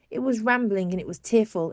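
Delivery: plain reading voice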